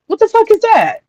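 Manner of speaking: high-pitched, incredulous question; mock outrage, pitch shooting up; baffled disbelief. Baffled by friend's mom's snack.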